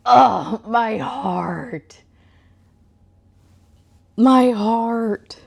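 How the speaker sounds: drawn-out, hammed-up ache; heartbroken. Real, not acted. Reader reacting to the heroine being forgotten, theatrically heartbroken. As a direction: groan it out as if the story wounds you.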